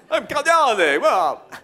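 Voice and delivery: loud, high cartoon count announcement; Count Yannick. Cheap cartoon count introducing himself, loud and hammy. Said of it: "my mouth moves like this".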